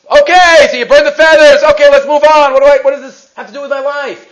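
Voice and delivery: loud, high mock-impatient listener; impatient dismissal. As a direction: brush it off loudly, eager to move on.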